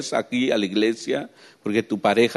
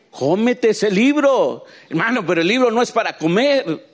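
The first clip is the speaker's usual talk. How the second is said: fervent exhortation; 10 semitones higher, 4 dB louder than usual. Real, not acted. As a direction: urge them hard. Predicador exhorta con fuerza. (exhortación fervorosa y alta)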